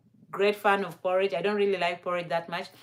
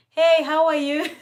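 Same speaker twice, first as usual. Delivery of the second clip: cheery greeting; 7 semitones higher, 6 dB louder than usual. Retold or imitated. Visitor greeting host cheerily.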